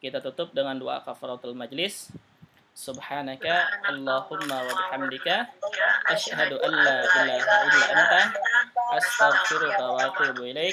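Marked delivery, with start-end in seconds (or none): 2.7-10.7 louder recited prayer in Arabic; louder chanted-style prayer to end the lesson; closing prayer. Acted.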